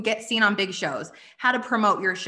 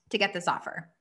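usual speaking voice

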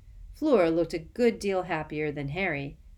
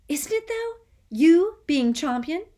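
very high, gushing; excited boy; 12 semitones higher, 9 dB louder than usual. Acted. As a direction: gush with admiration. Colin gushes.

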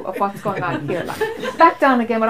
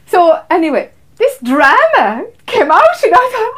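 laughing; 8 semitones higher, 9 dB louder, slower than usual. Real, not acted. Laughing retelling the drama.

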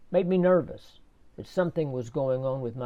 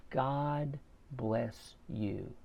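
slow, soft and heartfelt; a gentle, slowed-down thank-you; tender blessing; 2 semitones lower, 10 dB quieter, much slower than usual. Retold or imitated. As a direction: bless him quietly, drawing it out. Thanking the repairman on birthday.

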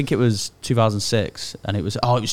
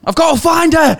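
high, loud hammed-up cry; mock panicked shout; 10 dB louder than usual. Friend hamming a soap scene, loud mock cry. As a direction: yell it like a frantic soap character.

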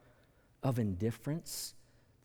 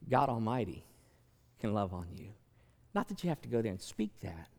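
ordinary talk, nothing marked

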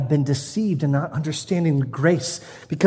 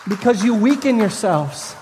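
ordinary talk, nothing marked

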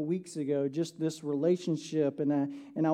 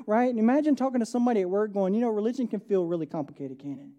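raised, louder preaching to the congregation; 4 semitones higher, 5 dB louder than usual. Acted. Pastor urging the church, preaching loud and raised. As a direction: preach it out with full force.

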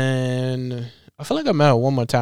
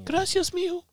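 very high mock mother voice; teasing a friend by imitating his mother, very high; mocking his mom. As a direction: squeak it out like a doting mom. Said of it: "Wanna keep on ridiculing my mother?".

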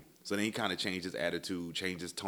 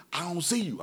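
high, raised-voice imitation of yelling; retold yelling; 11 semitones higher, 4 dB louder than usual. Tow guy can't find the caller, imitated yell. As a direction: mimic the irritated driver yelling. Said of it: "started yelling at me".

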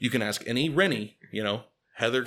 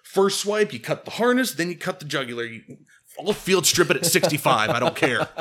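heated boast; 4 semitones higher, 6 dB louder than usual. Retold or imitated.

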